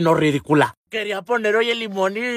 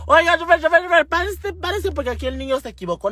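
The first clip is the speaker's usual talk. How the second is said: high-pitched calling to the driver; mock yell; 8 semitones higher than usual. Gallina lady shouting to the bus driver, mock yell. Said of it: "le grita al chofer".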